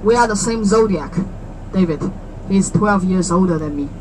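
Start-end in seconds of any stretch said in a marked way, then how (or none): none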